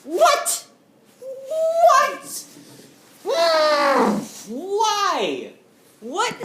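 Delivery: high loud disbelieving shriek; shocked. Retold or imitated. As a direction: react in utter disbelief. Shocked reaction to customer.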